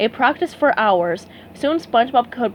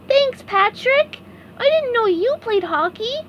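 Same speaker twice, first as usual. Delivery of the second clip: sincere little thanks; SpongeBob voice; 12 semitones higher than usual. A narrator's reading. SpongeBob thanking Patrick, high grateful voice.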